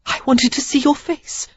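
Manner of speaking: high gasping voice; gasped. A narrator's reading. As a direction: gasp it out in pain. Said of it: "she gasped".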